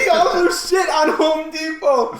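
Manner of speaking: high, loud shout, cracking up; gleeful shouted echo; hilarious disbelief. Real, not acted. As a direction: shout the punchline back in hysterics. Gleeful echo of the story's punchline.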